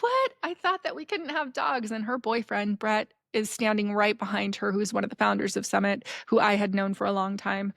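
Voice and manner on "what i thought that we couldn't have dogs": high-pitched, incredulous "what"; surprised. Retold or imitated. Festival guest sees a forbidden puppy, surprised. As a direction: leap up high in disbelief.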